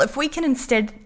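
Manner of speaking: usual speaking voice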